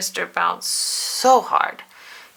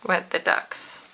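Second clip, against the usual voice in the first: plain reading voice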